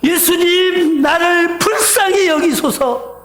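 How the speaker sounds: high, drawn-out cry for mercy. Acted. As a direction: call out slowly and desperately to be heard. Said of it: "부르짖는 거예요".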